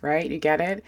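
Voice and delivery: usual speaking voice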